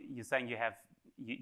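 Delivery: ordinary talk, nothing marked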